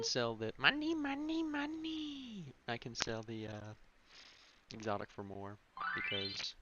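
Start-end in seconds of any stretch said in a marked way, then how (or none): 0.6-2.5 high, slow squawky cartoon duck. Mimics game character's money call.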